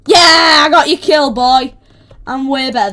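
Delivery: loud victory shout; triumphant yell. Real, not acted. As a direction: crow loudly over the stolen kill. Crowing over stolen kill.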